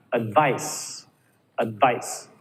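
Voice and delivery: "Advice" with a weak əd, stress on VICE; schwa in advice. Shown as a demonstration. Teacher models "advice" with a schwa start. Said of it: "Ad, not ad".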